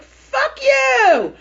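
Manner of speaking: high, very loud yell, played up; mock raging shout. Re-enacting the outburst at her sister, mock raging shout. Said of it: "yesterday I had a raging moment".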